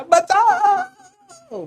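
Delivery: very high, drawn-out yell; drowning cry for help. Acted. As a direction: surface and yell for rescue, holding the note. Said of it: "चिल्लाता है".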